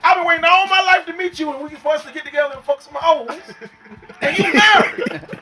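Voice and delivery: high, loud, laughing disbelief; mock rant. To his married rapper friend, a loud laughing mock rant. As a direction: roast your friend for getting married, hammed up.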